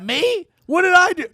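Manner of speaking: high, loud mock-offended protest; mock surprise. Bam answering the man who called him asshole, high mock indignation. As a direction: act wounded, then delighted.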